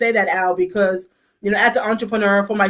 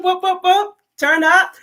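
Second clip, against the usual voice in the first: excited cheer; 10 semitones higher than usual. Real, not acted. Hyping up Bermuda again.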